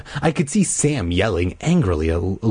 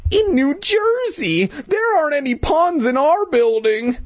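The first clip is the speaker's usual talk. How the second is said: very high, squeaky put-on kid; child hero voice. Hero asking Louisa about fun, squeaky kid voice. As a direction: pitch it way up for the young hero.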